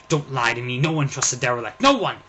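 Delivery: loud, rising furious outburst; angry refusal. Acted. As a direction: shout down the offer of help.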